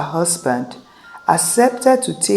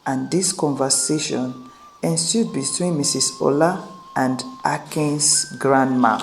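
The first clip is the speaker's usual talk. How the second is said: ordinary delivery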